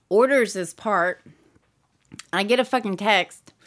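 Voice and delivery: ordinary delivery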